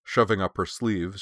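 ordinary delivery, nothing marked

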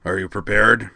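loud gravelly Klingon bark; gruff and blunt, a hint of gravel. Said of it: "replied a gravelly voice".

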